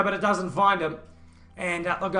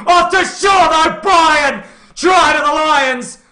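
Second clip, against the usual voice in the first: excited shouting; 9 semitones higher, 12 dB louder than usual. Real, not acted. Try scored, commentator erupts.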